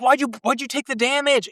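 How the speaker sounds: high-pitched, exasperated questioning; incredulous protest. Retold or imitated. Fan questioning an injured wrestler, incredulous. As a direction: plead with him in mock disbelief.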